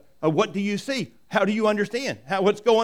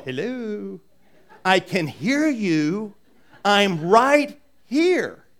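slow, louder, drawn-out emphatic words; pointed reminder; 3 semitones higher, 6 dB louder, much slower than usual. Retold or imitated. Jesus nudging the bickering disciples, slow and emphatic. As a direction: spell it out slowly like they're not listening. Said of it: "Jesus kinda going".

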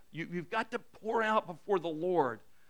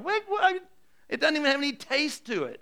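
high-pitched comic disbelief; hammed-up bafflement; 9 semitones higher than usual. Preacher baffled by tasteless quinoa, mock incredulous. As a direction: shoot the pitch way up in mock confusion.

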